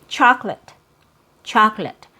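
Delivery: "chocolate" as CHAHK-lit, middle schwa removed; just two beats in "chocolate"; two-syllable chocolate. Shown as a demonstration. Teacher modelling for learners after explaining, "chocolate" as CHAHK-lit. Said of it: "you only hear two syllables".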